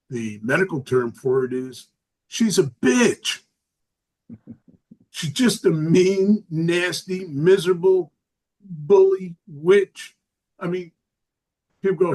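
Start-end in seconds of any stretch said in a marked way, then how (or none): 5.7-10.2 each word punched louder, slow at the end; a touch of venom, word by word; building rant. Acted. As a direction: pile up the insults, slowing down. Building insult about his sister.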